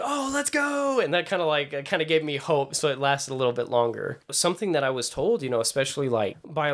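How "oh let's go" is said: high, drawn-out celebratory whoop; excited cheer. Retold or imitated. Actor hears he booked a commercial, excited cheer. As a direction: relive the thrill in a much higher voice.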